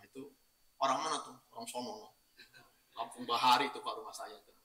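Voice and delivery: ordinary talk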